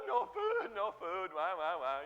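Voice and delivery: hammed-up complaint, sliding down; mock whining. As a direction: bawl like a hungry crybaby. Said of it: "whining, complaining".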